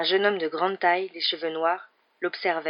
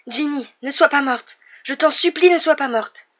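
high, loud, frantic begging; desperate plea, voice rising; desperate pleading; 9 semitones higher, 9 dB louder than usual. A narrator's reading. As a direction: beg her not to be dead. Harry begs over Ginny.

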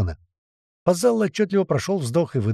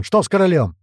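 raised, urgent question; yelling; 4 semitones higher, 5 dB louder than usual. A narrator's reading. Commander asks what happened to the king, yelling. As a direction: demand it loudly. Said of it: "орёт Туман".

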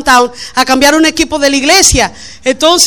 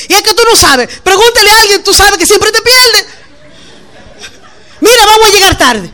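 nagging; 6 semitones higher than usual. Retold or imitated. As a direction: scold him with a laugh in your voice. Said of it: "pero después".

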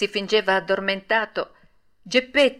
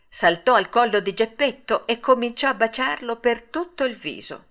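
ordinary delivery

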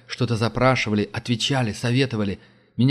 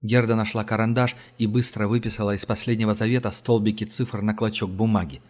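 ordinary delivery

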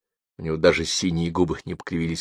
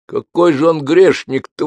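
higher, louder outraged defense; indignant protest; 9 semitones higher, 6 dB louder than usual. Acted. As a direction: bristle at the accusation. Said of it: "возмутился Череп".

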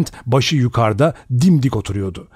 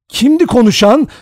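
loud, much higher, panicked cry; frightened shout; 12 semitones higher, 5 dB louder than usual. A narrator's reading. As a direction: cry out in fear. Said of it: "diye bağırdı Vera". (korkuyla bağırış)

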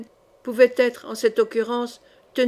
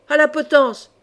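loud, higher cry of a stung mob; hurlement de bande; 4 semitones higher, 6 dB louder than usual. A narrator's reading. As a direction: shout it out like an enraged gang. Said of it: "hurla la bande cravachée par ces paroles". (cri fort et aigu d'une bande enragée)

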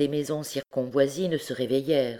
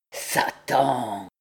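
drawn-out, grumbled oath; grumbling; 2 semitones lower than usual. A narrator's reading. Duke of Egypt cursing at the fallen beam, grumbling. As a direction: grumble it like a sullen old chief. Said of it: "grommela le duc d'Égypte".

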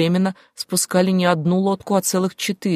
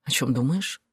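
soft, low man's voice; quiet question; 5 semitones lower, 6 dB quieter than usual. A narrator's reading. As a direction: ask it softly, pitched down. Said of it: "тихо позвал Клим". (тихий, низкий вопрос)

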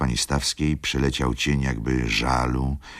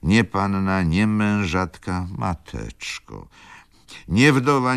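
put-on higher, indignant old woman's lament; bitter old lady; 8 semitones higher than usual. A narrator's reading. Grandmother complaining to the guest about her daughter's fate, indignant. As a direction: spit out each "nie" with scorn.

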